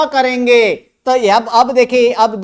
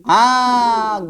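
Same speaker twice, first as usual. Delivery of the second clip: usual speaking voice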